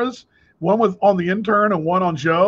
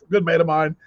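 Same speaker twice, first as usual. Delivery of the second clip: normal talking voice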